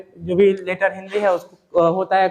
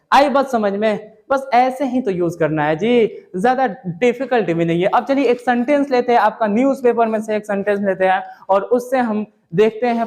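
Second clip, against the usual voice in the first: ordinary talk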